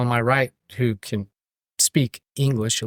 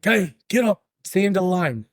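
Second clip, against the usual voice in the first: higher, brisk wake-up call; gruff conductor; 7 semitones higher than usual. Retold or imitated. Conductor telling the drunk passenger to wake up. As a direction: rouse a passed-out rider.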